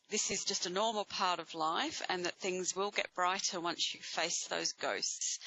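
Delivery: ordinary talk, nothing marked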